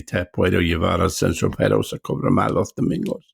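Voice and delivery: Spanish line recited as learned for the film; Andalusian Spanish line. Shown as a demonstration. Proving he still remembers his Spanish film line. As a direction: recite the line again from memory. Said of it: "with an Andalusian accent there".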